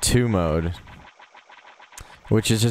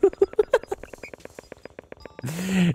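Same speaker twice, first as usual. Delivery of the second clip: high giggle; 12 semitones higher than usual. Real, not acted. Laughing after impression.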